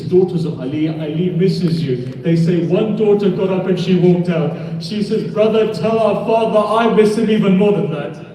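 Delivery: climbing into a high, loud mourning chant; lamenting recital. Acted. Preacher to mourners, voicing the daughter's longing, rising loud lament. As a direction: let the grief swell line by line.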